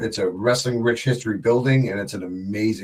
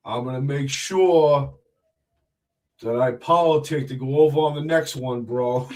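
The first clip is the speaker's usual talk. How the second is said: slow, drawn-out wrestler promo voice; Big Vito impression; 3 semitones higher than usual. Guest shows the host his Big Vito, slow drawn-out impression. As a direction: stretch every word, put-on tough-guy wrestler. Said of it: "I can do a Big Vito impression".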